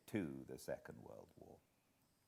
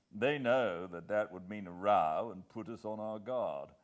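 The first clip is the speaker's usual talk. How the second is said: lightly voiced brisk English gentleman, a touch louder; a hint of a senior official briefing, firm and louder; Sir Walter; 8 dB louder than usual. Sir Walter briefing Hannay over breakfast, lightly voiced and firm.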